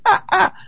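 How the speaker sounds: high, short gasping yelps; frightened yelps. Retold or imitated. Grabbed by the race director, frightened high yelps. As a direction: let out two startled cries. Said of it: "it wasn't screaming, but I was going".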